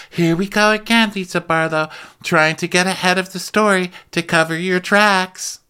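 high mock accusing voice; imitating Whitney. Podcast host mimics Whitney accusing Lisa, high mocking voice.

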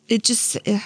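ordinary talk

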